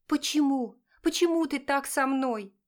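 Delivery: put-on desperate girl's voice, pitched high; despairing cry. A narrator's reading. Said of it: "в отчаянии воскликнула девушка".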